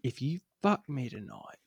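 low murmured warning, close in; hushed threat. Acted. Boss warns driver close in his ear, hushed threat. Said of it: "and I'm saying".

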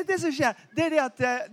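normal talking voice

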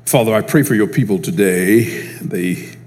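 soft, low prayer voice; closing prayer. Acted. Preacher praying softly over his congregation. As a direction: pray quietly over the listeners.

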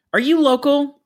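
loud, very high screech of a question; screaming caller. Retold or imitated. Angry planner calling the office line, screaming. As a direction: shriek it down the phone at the receptionist. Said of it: "She starts unleashing on me".

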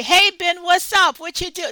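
loud, overly familiar hey to the professor; casual loud greeting. Retold or imitated. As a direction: holler a careless hi across the room. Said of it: "You can't go in and say".

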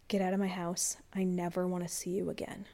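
quiet, steady, unvoiced whisper; cold, calm dismissal whispered to the boyfriend. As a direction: say it low and very calm, no anger. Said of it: "And this calmly, I said".

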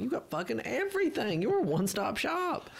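very high-pitched exaggerated astonishment; mock amazement. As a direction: exclaim it as if floored by the hustle. Retold amazed reply to pushy seller.

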